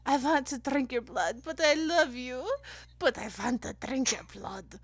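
put-on hammy old-world vampire accent. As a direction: ham up the classic movie vampire. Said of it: "Or the classic vampire".

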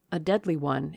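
plain reading voice

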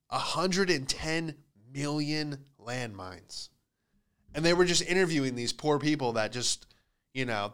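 ordinary talk

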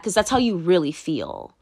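ordinary delivery

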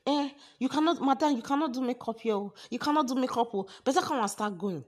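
high, loud scolding of someone breaking the rules; imitated shouting. Girl confronting a madam doing makeup, imitated high shouting. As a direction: shout it like an indignant attendant. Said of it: "she's shouting".

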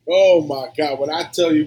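usual speaking voice

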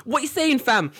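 loud, high-pitched London street greeting; chav. Acted. Londoner greeting his own people, mock chav call. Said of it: "this is the chav squat".

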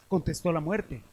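ordinary delivery, nothing marked

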